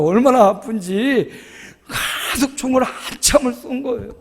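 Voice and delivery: ordinary talk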